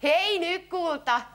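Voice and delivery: ordinary delivery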